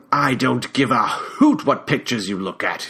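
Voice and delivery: lightly voiced older Englishman with a British accent; clipped British reproach, held back; stern British-accented scolding. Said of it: "in that British accent of his".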